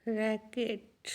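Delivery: रैकेट slow and soft, "rack-et"; syllables sounded out. Shown as a demonstration. Teacher helping pupils find syllables, रैकेट slowly and softly sounded out. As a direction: draw out the two beats of रैकेट. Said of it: "syllables find करते जाइए".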